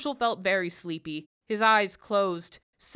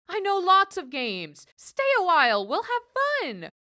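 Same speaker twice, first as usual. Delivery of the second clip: high, louder coaxing plea; mock pleading; 11 semitones higher, 5 dB louder than usual. Herschel pretending to plead with the goblin, high coaxing plea. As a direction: beg him to stay, sing-song high. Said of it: "Herschel pleaded".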